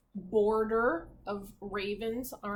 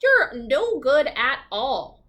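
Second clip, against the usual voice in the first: slow, louder sneer; 7 dB louder, slower than usual. Acted. Old woman scornfully dismissing Crawford, slow and loud. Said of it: "the old woman sneered".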